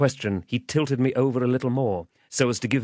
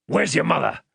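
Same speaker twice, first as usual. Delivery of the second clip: higher, threatening demand; menacing man; 6 semitones higher, 3 dB louder than usual. Acted. Man demanding the child's name, menacing. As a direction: play a rough interrogator bullying a child.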